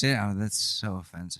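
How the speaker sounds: ordinary talk, nothing marked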